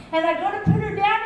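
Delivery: ordinary delivery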